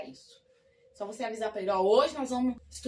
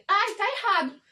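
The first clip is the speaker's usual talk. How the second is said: higher, louder fussy relative scoffing; mock fussy relative; 8 semitones higher, 8 dB louder than usual. A relative scoffs at the learner's English, high and loud. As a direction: squeal the correction as a nagging relative.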